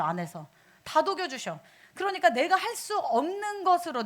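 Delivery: ordinary talk, nothing marked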